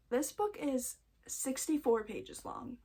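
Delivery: ordinary delivery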